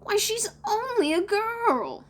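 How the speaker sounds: very high, slow scornful protest; drawn-out scandalised boy; aghast boy. A narrator's reading. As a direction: wail the objection in a put-on child voice. John aghast at serving a girl.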